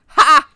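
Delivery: sudden explosive "ha"; re-enacted public guffaw; loud out-loud laugh. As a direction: burst out with one very loud laugh. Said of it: "out loud, in a public space, went".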